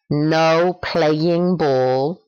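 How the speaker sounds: very slow, careful model sentence. Shown as a demonstration. Teacher offering another phrasing, said slowly. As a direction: spell out No playing ball in the house word by word. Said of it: "También puedes decir".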